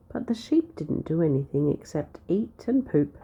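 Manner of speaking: ordinary delivery, nothing marked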